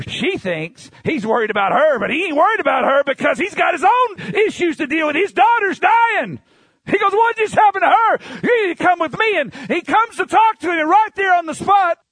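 climbing high and loud into a fiery shout; preaching at full force. Acted. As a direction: preach it hard, rising to a roar.

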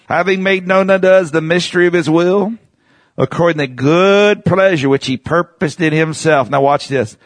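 slow, drawn-out, rising reading; lightly heightened Bible reading; scripture reading. Acted. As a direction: read each phrase with weight. Reads scripture aloud.

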